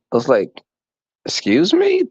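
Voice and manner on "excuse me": high indignant excuse me; much higher affronted question; offended surprise. Retold or imitated. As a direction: act shocked and insulted. Offended by the compliment.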